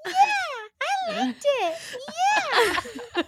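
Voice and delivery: put-on high, giggly baby-girl squeak; squeaky cute anime girl. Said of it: "So she sounded like this".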